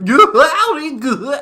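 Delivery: very high, loud caricature howdy; goofy mocking hecklers. People up north aping the Southerner's greeting, loud goofy mockery. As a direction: hoot it in exaggerated mimicry. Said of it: "They'd be like".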